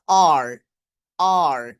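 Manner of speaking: "আর" low, tongue held mid-mouth; low, strongly sounded R in "আর"; American R. Shown as a demonstration. Teacher demonstrates tongue position, American R. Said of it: "মানে আপনার জিহ্বা এটা মাঝখানে থাকবে".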